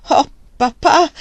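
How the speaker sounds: high, loud and slowed begging cry; pleading cry. Acted. Margaret begging her father, loud pleading cry. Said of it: "gridò supplicandolo con inaspettato trasporto".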